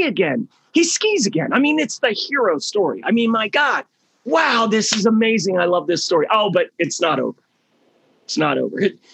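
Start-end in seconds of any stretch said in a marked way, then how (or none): 0.7-1.5 excited. Acted. Building excitement in hero story. 3.3-5.6 excited. Retold or imitated. Audience member gushing over story.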